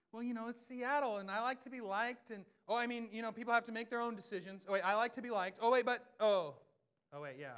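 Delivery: high, loud, flustered dithering; hammed-up anxious to be liked; dithering people-pleaser. Mock Seattle churchgoer who likes to be liked, flustered.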